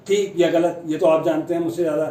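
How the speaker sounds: normal talking voice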